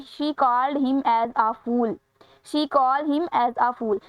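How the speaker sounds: reading the sentence very slowly. Shown as a demonstration. Teacher reading a quiz item aloud, very slow. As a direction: read it word by word for the class.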